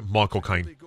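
low, soft Cockney impression; Michael Caine. Showing the easy impression, low Cockney accent. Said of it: "Michael Caine's easy. You just".

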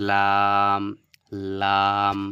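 লাম said loud, tongue tip touching the upper gum; Arabic letter lam. Shown as a demonstration. Teacher modelling লাম for students, loud and clear. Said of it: "তাহলে কী হলো?".

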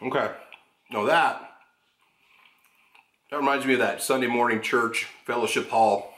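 chewing while talking; speaking between bites of cake; mouth partly full. Real, not acted. Seen 4: bite taken at -1; chews early while holding cake plate.

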